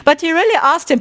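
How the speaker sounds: ordinary delivery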